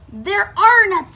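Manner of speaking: loud, high, drawn-out cry; big storybook exclamation; exclaiming. A narrator's reading. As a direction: exclaim it slowly in dismay. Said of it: "exclaimed Bruce".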